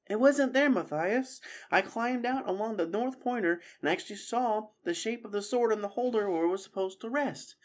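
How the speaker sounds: high-pitched character explaining earnestly; apologetic squirrel. Acted. Squirrel regretfully explains to Matthias.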